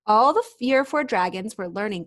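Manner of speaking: usual speaking voice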